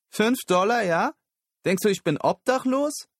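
high, loud furious yell; indignant shriek. A narrator's reading. Street girl yelling after Lyle over five dollars. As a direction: shriek it after him, insulted.

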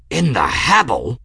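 loud, startled blurt. A narrator's reading. Aeronaut hearing the town is being firebombed, startled blurt. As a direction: blurt it out in disbelief. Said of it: "Kettle blurted".

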